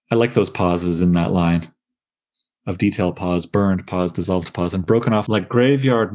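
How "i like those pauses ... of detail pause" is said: usual speaking voice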